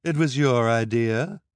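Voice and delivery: a lightly voiced eager newswoman; eager reporter. A narrator's reading. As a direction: lean in, impressed and nosy.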